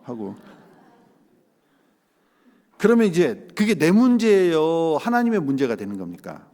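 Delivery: ordinary delivery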